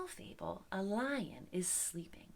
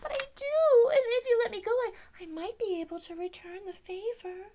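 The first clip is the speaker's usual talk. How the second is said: very high, loud pleading mouse; frightened mouse; 11 semitones higher, 7 dB louder than usual. Acted. Mouse begs lion not to eat him, frightened mouse. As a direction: stammer a high, urgent little plea.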